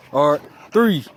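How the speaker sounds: hammed-up boxing ring bell; fight announcer countdown. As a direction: call the round start like a ring announcer. Mock boxing match countdown.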